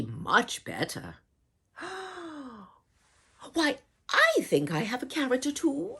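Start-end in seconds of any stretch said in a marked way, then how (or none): 1.8-2.5 sharp breathy gasp of delight; little storyteller gasp. Pretend. Storyteller reacts in read-aloud. 3.5-6.0 higher, quick eager voice; a lightly voiced eager woman; eager villager. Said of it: "said Francoise".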